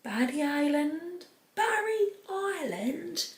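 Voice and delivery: high, drawn-out Welsh lilt; soft, slow, exaggerated Welsh catchphrase; Gavin and Stacey impression. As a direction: stretch it out in a sing-song Welsh accent. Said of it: "Gavin and Stacey".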